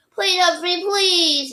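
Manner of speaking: high, drawn-out plea; begging. A narrator's reading. Boy begging his hamster, high plea. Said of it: "Daniel begged me".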